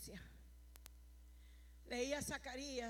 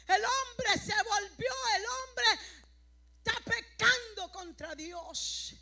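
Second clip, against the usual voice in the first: slow, high, stretched-out pulpit cry; preaching denunciation; 7 semitones higher, 10 dB louder, slower than usual. Acted. Preacher denouncing sin to the congregation, slow preaching cry. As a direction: draw out the accusation in a raised pitch.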